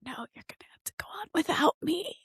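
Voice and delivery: melodramatic, hurried whisper; mock voiceless farewell. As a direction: whisper it like a dramatically doomed singer. Voiceless singer's dramatic farewell, retold.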